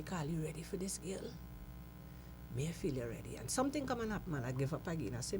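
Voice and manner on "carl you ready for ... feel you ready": quiet self talk. Retold or imitated. Talking to self before show.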